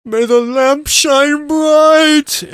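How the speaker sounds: slow, high, drawn-out shout; battle cry. Acted. Rider yelling a blessing as he whips the ponies, long loud cry. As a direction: bellow it like charging into danger.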